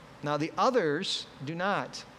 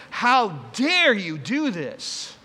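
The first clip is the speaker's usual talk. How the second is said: slow, loud, higher scolding; 4 semitones higher, 7 dB louder, much slower than usual. Acted. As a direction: berate her word by word. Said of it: "they scolded her".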